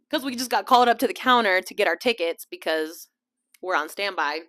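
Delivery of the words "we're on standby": ordinary talk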